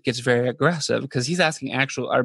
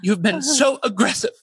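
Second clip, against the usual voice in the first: raised, accusing mock tone; mock Megan; 6 semitones higher than usual. Sister accusing the salesman, mock high. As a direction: accuse him, pitched up. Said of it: "she goes like".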